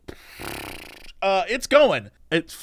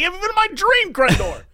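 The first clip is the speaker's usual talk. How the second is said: high frustrated outburst; exasperated shout; 10 semitones higher than usual. Real, not acted. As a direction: wail it in frustration. Frustrated complaint.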